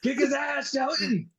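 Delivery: high, hammed-up cheering shouts; mock ringside mama. Mama cheering her son Shelton from ringside, high mock shouts. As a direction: yell for your boy like a wild fan.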